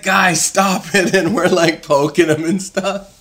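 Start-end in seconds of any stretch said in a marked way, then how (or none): 0.0-1.1 put-on high, slow whiny sick boy; a hint of a queasy kid pleading. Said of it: "he's like".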